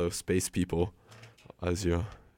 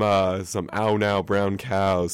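ordinary talk, nothing marked

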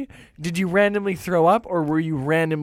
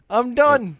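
mock British accent, higher pitch; a hammed-up British take on the fighter; 8 semitones higher, 3 dB louder than usual. McGregor quitting the fight, in a mock British accent. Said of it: "that was a British accent".

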